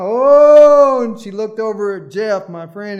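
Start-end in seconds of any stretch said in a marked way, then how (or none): 0.0-1.0 high, loud mock groan of oh; old lady groaning aloud in the nursing home, high and loud; old lady's loud groan. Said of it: "she did this another time".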